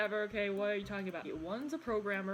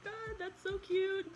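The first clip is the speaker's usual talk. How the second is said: higher, squealing mock gush; 10 semitones higher than usual. Fan reacting to a cute couple, higher squealing gush. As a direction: squeal over something adorable. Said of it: "lots of feelings of like".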